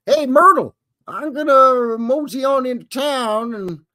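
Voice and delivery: high, hammed-up rancher calling to his wife; old cowboy. Cowboy telling his wife Myrtle he's going to town. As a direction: play a folksy old farmer heading to town.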